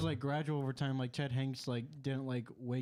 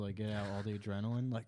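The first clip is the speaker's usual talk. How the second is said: normal talking voice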